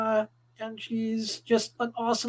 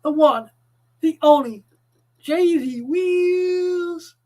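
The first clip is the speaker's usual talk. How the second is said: announcer hype; 6 semitones higher, 6 dB louder, much slower than usual. Acted. Introducing co-host.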